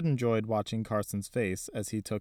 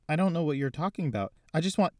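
quick, higher, flustered protest; flustered denial; 7 semitones higher, 3 dB louder, faster than usual. Acted. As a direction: talk fast, caught out and nervous. Isaac flustered, denying fast.

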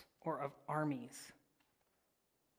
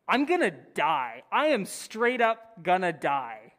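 mock terror; 8 dB louder than usual. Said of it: "He is terrified".

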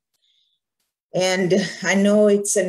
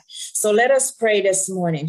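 normal talking voice

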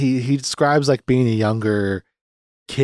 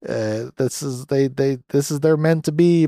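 excited viewer; 5 semitones higher than usual. Retold or imitated. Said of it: "And you're like".

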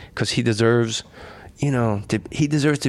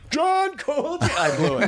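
wildly overdone line read, pitched way up; overacting; slower than usual. Actor blowing his audition line, overacting with a laugh. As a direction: ham up the name like a bad audition. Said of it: "totally overacting".

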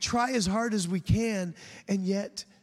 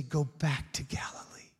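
hushed quote; 5 semitones lower, 7 dB quieter than usual. Retold or imitated. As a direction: drop to a soft whisper. Quoting Jesus's instruction in a sermon.

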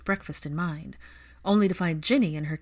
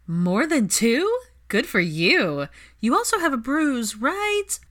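slow loud gleeful tease; savoring the gossip; teasing delight; 7 semitones higher, 5 dB louder, slower than usual. Acted. Ginny teasing about her night.

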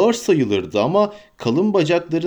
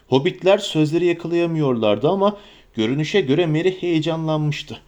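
ordinary delivery

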